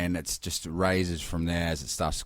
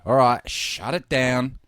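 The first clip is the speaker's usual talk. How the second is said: higher, drawn-out closing call; imitated slow, raised announcement; closing-time call; 5 semitones higher, 3 dB louder than usual. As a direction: call time like a trader shutting up shop. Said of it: "They go".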